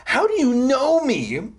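high, agitated demand; rising voice. A narrator's reading. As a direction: let the pitch climb in alarm. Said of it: "My voice started to rise".

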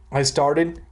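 ordinary talk

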